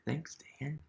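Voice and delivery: whispered thanks; a little aside in a whisper. Real, not acted. Reader thanking the author in a whisper.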